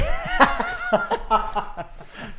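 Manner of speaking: high, loud burst of laughter; real laughter. Real, not acted. Podcast guest cracking up at his own joke, real laughter.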